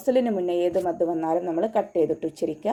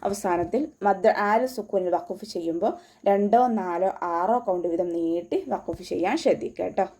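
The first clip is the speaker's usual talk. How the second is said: usual speaking voice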